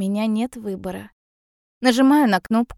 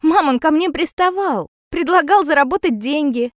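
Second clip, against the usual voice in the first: higher, louder pleading to mother; urgent protest; 6 semitones higher, 5 dB louder than usual. A narrator's reading. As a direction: plead to be believed. Girl pleads her case to mother.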